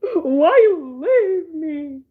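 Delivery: very slow, whiny and tearful; hammed-up sad whine; mock whimpering. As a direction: plead like an abandoned kid. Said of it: "looking at his phone like".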